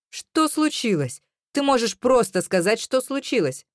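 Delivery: high, pressing, exasperated question; exasperated demand. A narrator's reading. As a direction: demand an explanation, losing patience. He demands to know what happened.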